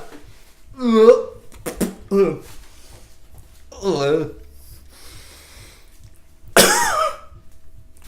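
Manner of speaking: drawn-out coughing after a bite; long coughing fit. Real, not acted. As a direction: keep coughing through it.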